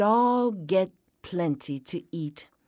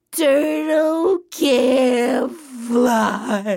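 loud, long drawn-out high jeer; taunt, stretching every word; taunting bird; 5 semitones higher, 6 dB louder, much slower than usual. A narrator's reading. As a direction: drag it out slowly and loudly. Birds mock turtle in told story.

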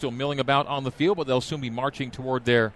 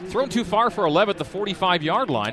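excited call; 5 semitones higher, 4 dB louder than usual. Real, not acted. Near catch on a long pass.

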